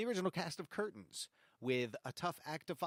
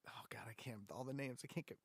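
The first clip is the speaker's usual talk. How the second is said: flustered whisper to herself; breathy whispered fluster; whispered aside; 4 semitones lower, 12 dB quieter, faster than usual. Real, not acted. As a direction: mutter it under your breath, losing the names. Flustered, forgetting names.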